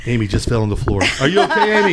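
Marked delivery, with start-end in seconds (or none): 1.2-1.9 asking through laughter; can barely get it out laughing. Real, not acted. Co-host fell laughing.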